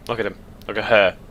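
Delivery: usual speaking voice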